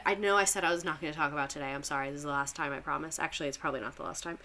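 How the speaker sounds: sheepish undertone, quiet and low; apologetic aside. Real, not acted. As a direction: drop your voice and hurry through the apology. Apologetic aside for going off plan.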